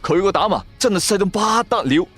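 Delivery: ordinary delivery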